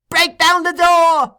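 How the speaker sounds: high, loud, drawn-out scream; a boy screaming at the crowd. A narrator's reading. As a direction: scream it through the walls. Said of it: "screamed the boy".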